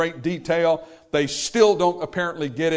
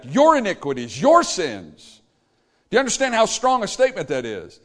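normal talking voice

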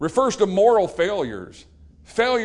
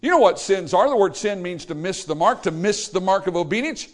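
ordinary delivery

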